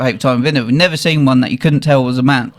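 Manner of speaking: ordinary delivery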